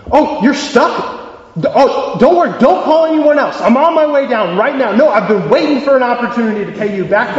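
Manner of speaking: high, excited rush to help; urgent, delighted reassurance on the phone; eager reaction. Acted. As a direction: jump at the chance to repay a friend. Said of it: "What would his reaction be?".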